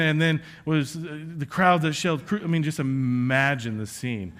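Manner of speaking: ordinary talk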